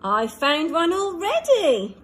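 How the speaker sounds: excited cry. A narrator's reading. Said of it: "cried Little Rocket".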